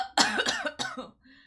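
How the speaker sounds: loud burst of real coughing; dramatic cough. Real, not acted. Sick streamer interrupted mid-greeting, loud coughing. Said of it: "It sounds like I'm being so dramatic".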